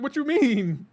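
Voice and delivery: high incredulous squeak; high shocked question; mock baffled. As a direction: play it baffled and shrill. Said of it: "It's like".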